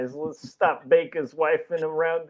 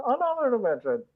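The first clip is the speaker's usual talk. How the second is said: higher robot echo on "another dimension"; 7 semitones higher than usual. Pretend. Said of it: "You have to say it in a robot voice".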